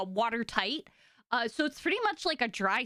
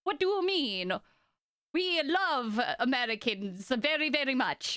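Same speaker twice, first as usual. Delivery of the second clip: badly faked Russian accent, pitched up at first; awful Russian accent. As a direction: attempt a hammy Russian accent. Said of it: "that's an awful Russian accent".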